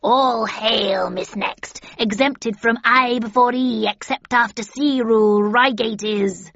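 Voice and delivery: loud, slow crone cackle-voice; cackling witch. A narrator's reading. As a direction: cackle the prophecy like a hag. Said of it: "cackled the second".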